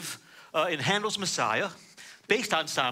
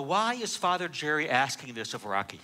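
ordinary delivery

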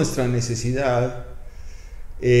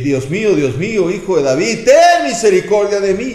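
loud, high desperate plea; crying out to God; 7 semitones higher, 7 dB louder than usual. Acted. Preacher cries out to God like the blind man. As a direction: shout it up to heaven, begging. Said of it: "y comenzamos a gritar".

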